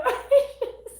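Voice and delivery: short high-pitched giggle; high squeaky laugh. Real, not acted. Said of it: "swallowed a bug".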